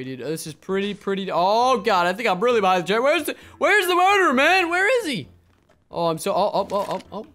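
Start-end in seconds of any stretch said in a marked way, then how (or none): 3.0-5.3 high, loud, anxious questioning; panicky demand. Real, not acted. Sheriff hunting for the murderer, panicky demand. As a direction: cry out, pitched way up with nerves.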